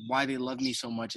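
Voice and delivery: ordinary delivery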